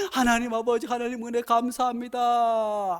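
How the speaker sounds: loud, high ecstatic thanks to God; grateful prayer. Retold or imitated. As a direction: cry out thanks as if tearful with joy. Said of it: "하고".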